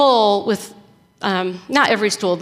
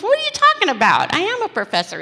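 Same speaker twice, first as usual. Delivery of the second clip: incredulous protest; 9 semitones higher, faster than usual. Retold or imitated.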